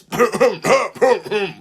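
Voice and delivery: loud fake interrupting cough; big deliberate throat-clearing cough. A narrator's reading. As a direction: cough noisily to cut someone off. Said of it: "Gordon coughed loudly".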